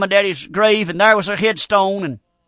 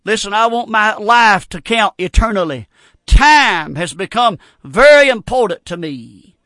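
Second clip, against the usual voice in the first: ordinary talk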